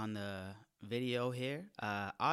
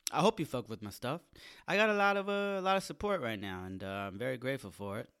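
usual speaking voice

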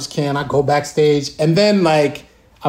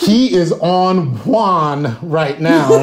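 slow, laughing through the words; drawn-out amused quote from the cast; much slower than usual. Retold or imitated. Cast members laughing at his wild drumming, slowly. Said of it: "They're like".

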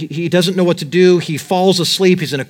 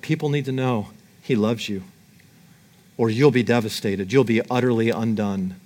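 tender hush; 5 semitones lower, 4 dB quieter than usual. Acted. Tender word of God's love.

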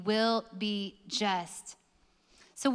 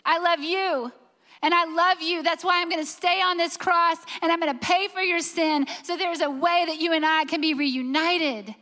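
raised, impassioned declaration, pressing each repeat; Jesus declaring love; 4 semitones higher, 4 dB louder than usual. Acted. Jesus on the cross tells you why he stays, impassioned declaration. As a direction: plead love earnestly, pitched higher. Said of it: "and he said".

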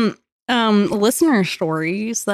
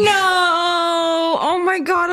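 shocked gasp; 7 semitones higher, much slower than usual. Real, not acted. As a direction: gasp then a high laughing "No!". Shocked reaction to story.

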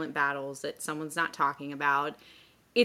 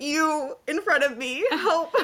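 mock helpless plea; 9 semitones higher, 4 dB louder, slower than usual. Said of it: "be like".

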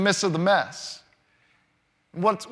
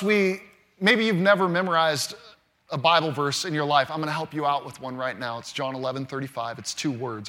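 usual speaking voice

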